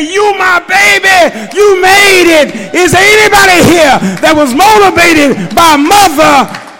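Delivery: fervent shouting preacher; preaching at full force. Acted. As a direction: bring the congregation up with a roar.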